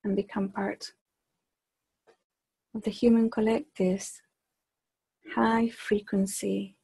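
measured meditation voice with long, unhurried pauses; guided meditation. Acted. Leader guiding a group to extend their awareness, guided meditation. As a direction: guide them gently inward, phrase by phrase, softening as it goes.

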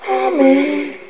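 ordinary delivery, nothing marked

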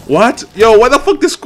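loud, high, baffled outburst; incredulous protest. Real, not acted. Gamer baffled by Sub-Zero running, loud incredulous protest. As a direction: demand an answer, voice jumping up. His character runs unexpectedly.

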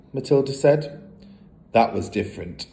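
ordinary delivery, nothing marked

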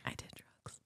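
unvoiced whisper, barely there; whispered confession. Friend whispering the confession for the teller, hushed. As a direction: breathe the confession out like a secret.

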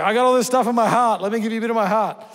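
a father bursting to give his heart away; gushing dad, voice lifted high; eager dad. Retold or imitated. Dad voicing himself to daughter.

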